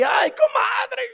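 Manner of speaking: very high, slow whiny sigh; mock lament; whining to a friend. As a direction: complain to your gossip friend like a pitiful sufferer. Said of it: "vas ahí con la comadre".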